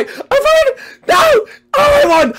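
very high, loud screaming joy; squealing victory cry; ecstatic shriek. Real, not acted. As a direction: shriek it out with the win. Winning the race, shrieking.

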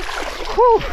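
sharp cold exhale. Real, not acted. Entering cold water.

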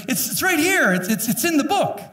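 exasperated. Real, not acted. Pastor waving the Bible at the congregation, exasperated. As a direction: stammer it out, incredulous at them.

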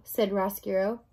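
ordinary delivery, nothing marked

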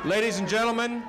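loud announcer opening to the crowd; announcer call. Real, not acted. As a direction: call it out to the whole hall. Opening a rally introduction.

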